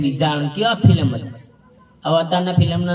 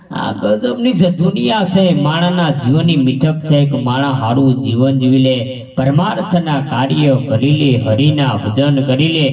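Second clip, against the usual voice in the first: preaching; 2 semitones lower, 4 dB louder, slower than usual. Acted. Preaching life's sweetness.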